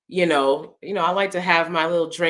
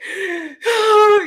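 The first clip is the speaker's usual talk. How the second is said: mock gasp; 9 dB louder than usual. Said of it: "my husband is not a guy to be like".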